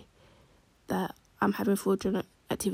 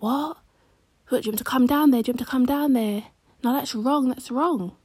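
higher and louder, overdone alarm; mock shocked friend; 5 semitones higher, 6 dB louder than usual. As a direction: play the fraudster gushing fake concern. Friend acts shocked over fraud.

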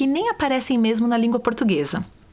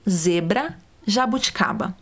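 ordinary talk, nothing marked

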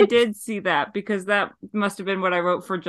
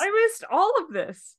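high, soft, drawn-out wail; mock dismay; 11 semitones higher, 6 dB quieter, slower than usual. Co-host admitting she missed it all, mock dismay. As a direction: echo it back in stretched, playful bewilderment.

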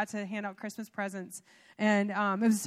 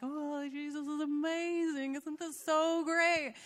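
slow, higher, dreamy gushing; gushing husband; 5 semitones higher, slower than usual. Retold or imitated. Wife imitating her husband on every trip, dreamy gushing. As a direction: imitate a blissed-out enthusiast, drawn out. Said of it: "he's always just like".

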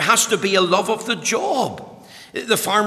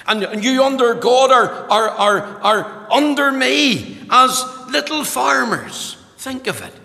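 raised, ringing pulpit voice; preaching at full force; 6 semitones higher, 5 dB louder than usual. Acted. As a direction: preach it out loud and high. Opening of sermon, full force.

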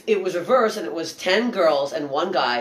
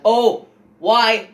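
higher and louder, a retold demand at the crowd; mock outraged challenge; 6 semitones higher, 9 dB louder than usual. Comedian retells challenging a sensitive crowd, mock loud and outraged. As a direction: throw it back at them, mock indignant.

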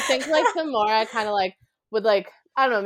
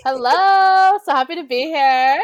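excited greeting; 7 semitones higher, 7 dB louder than usual. Real, not acted. Guest greets podcast audience.

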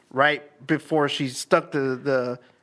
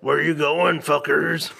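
demon voice; slower than usual. Retold or imitated. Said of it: "Actually, that was probably better".

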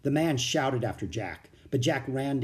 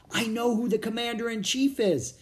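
higher, eager and urgent; exclaimed; 9 semitones higher than usual. A narrator's reading. Jack grabbing Annie's arm, excitedly exclaiming. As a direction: blurt out the discovery. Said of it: "he exclaimed".

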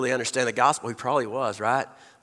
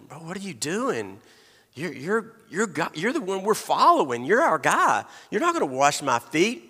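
higher, incredulous Peter pushing back; protesting Peter; 5 semitones higher than usual. Acted. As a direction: play Peter refusing, baffled. Peter protests foot washing.

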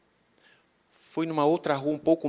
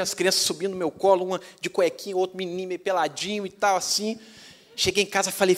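normal talking voice

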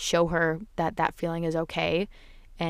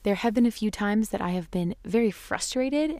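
ordinary talk

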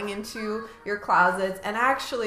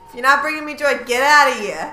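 loud mock eviction, hammed-up; mock angry; 5 semitones higher, 9 dB louder, faster than usual. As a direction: kick it out with fake fury. Acted eviction of clutter item.

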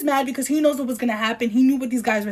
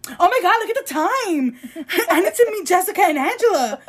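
high, hammed-up obliviousness breaking into laughter; mocking imitation; 6 semitones higher than usual. Host mimicking her reaction to his anger, mocking. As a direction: exaggerate her sudden excuse, pitch way up.

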